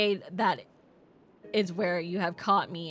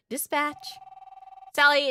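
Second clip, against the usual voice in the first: ordinary talk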